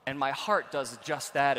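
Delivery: ordinary delivery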